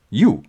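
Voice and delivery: YOU stressed with weight. Shown as a demonstration. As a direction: lean on You to single him out. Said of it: "I'm gonna emphasize that".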